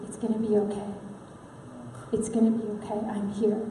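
soft, hushed reassurance; low-volume, tender and soothing; God's reassuring whisper. Retold or imitated. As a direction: comfort someone gently in the dark. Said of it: "God's voice".

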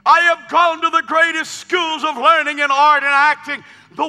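loud, high rising storyteller preaching; preaching story at full force. Acted. Preacher telling the congregation about the applause, loud. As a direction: lift the crowd with the tale. Preacher voices the amazed actor.

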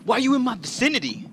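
loud, high, fast mock outrage; hammed-up high-pitched indignation; indignant monster. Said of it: "They're like".

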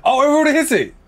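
high, loud burst of surprise; startled and loudly excited; surprised outburst. Real, not acted. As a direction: exclaim it, caught off guard by the news. Like goal suddenly reached.

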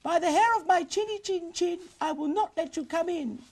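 very high piggy voice; little pig voice. Acted. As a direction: squeak defiantly like a little pig.

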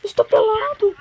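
high loud whining; whiny cry. Pretend. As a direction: wail it like a victim. Voicing character in distress.

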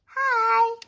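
high, loud pretend-kid greeting; put-on squeaky little voice; little sibling voice. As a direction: squeal hello like a small child.

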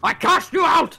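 high, loud dramatic casting cry; mock spell shout. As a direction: bellow it like a wizard banishing. Mage casting at enemy.

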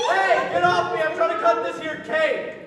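high, loud protest; annoyed shout. Acted. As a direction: shout someone off you mid-task. Struggle in the dark.

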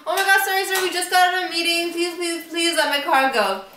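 high, rushed apologetic begging; overly nice pleading. Retold or imitated. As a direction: plead sweetly with the tow driver. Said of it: "so nice to this man".

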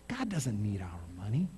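hushed, confiding whisper; a sudden whisper in the middle of the sermon. Real, not acted. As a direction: lean in and whisper it to the room. Preacher drops to a whisper.

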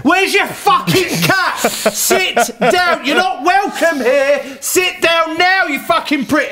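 high, drawn-out abusive yelling; heckling. Retold or imitated. Players abusing the singer from the back, shouting. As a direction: holler each jeer slowly at a high pitch. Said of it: "All I'm hearing from the back".

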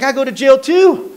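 light up at finding something in common; eager recognition. Retold or imitated. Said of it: "I was like".

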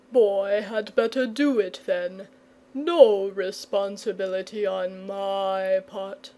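slow, drawn-out, sleepy dragon voice; drowsy dragon murmur. A narrator's reading. Dragon settling down in the road, drowsy murmur. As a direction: drift towards sleep, stretching out the words. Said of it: "he murmured".

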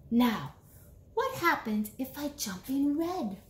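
usual speaking voice

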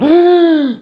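loud high shocked gasp; sharp theatrical gasp; mock gasp. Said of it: "they've gone".

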